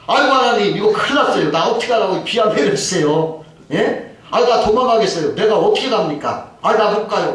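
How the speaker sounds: high-pitched, acted-out grumbling at God; whining complaint. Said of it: "막 원망, 투정을 하면서". (원망하고 투정하는 높은 목소리)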